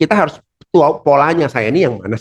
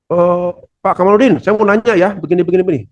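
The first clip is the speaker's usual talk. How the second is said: higher, eager voice of a boss asking a question; a superior asking the presenter a question; 6 semitones higher than usual. Retold or imitated. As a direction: call out a question from across the room. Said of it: "atasan kita sebelah sana bertanya".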